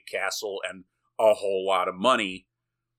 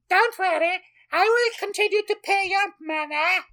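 very high, loud falsetto; hammed-up girlish boy lord, squealing reassurance; squeaky young Victor; 5 dB louder than usual. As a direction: promise it in a piercing mock-girly squeak. Redoes Victor's line in exaggerated voice.

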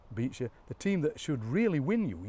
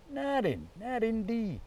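high-pitched Sheffield accent with hard D; 10 semitones higher, slower than usual. Shown as a demonstration. Showing off Sheffield speech, high caricature accent. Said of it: "where they have the hard D".

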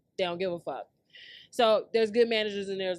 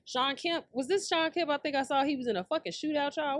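ordinary talk, nothing marked

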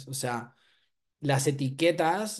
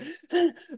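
high, hammed-up breathless gasp; mock starstruck gasp. Mocking fans who can't breathe when they meet him, hammed-up gasp. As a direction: gasp like an overwhelmed fan. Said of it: "les cuesta respirar".

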